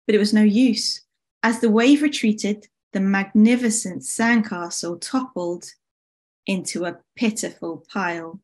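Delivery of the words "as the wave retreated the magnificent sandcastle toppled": ordinary delivery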